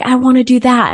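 put-on a child's eager high plea. Little girl pleading with her mom, high and eager. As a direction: beg for it like a kid. Child wanting what others have.

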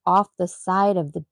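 normal talking voice

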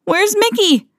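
high, frightened cry; high panicky cry for his lost friend; alarmed cry. A narrator's reading. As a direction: call out in a panic for a missing friend. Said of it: "Donald cried".

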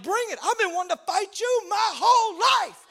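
high, loud taunting yell; cocky shout. Retold or imitated. Skinny speaker taunting the furious big guy, loud cocky shout. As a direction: dare him to come at you. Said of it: "I'm like".

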